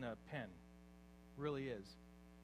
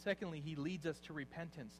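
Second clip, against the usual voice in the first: usual speaking voice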